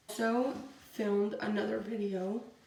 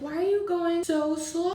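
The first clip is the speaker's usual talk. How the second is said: higher, exasperated retold question; mock annoyed; 7 semitones higher, 3 dB louder than usual. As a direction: replay your irritation at him, pitched up. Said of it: "I was getting so mad".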